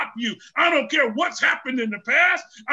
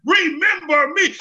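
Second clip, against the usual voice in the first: loud, high plea; crying out; 5 semitones higher, 5 dB louder than usual. Acted. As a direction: beg to be remembered. Samson's prayer voiced in sermon.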